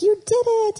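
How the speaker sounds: high, loud mock cheer. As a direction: cheer the toddler on. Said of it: "They're like".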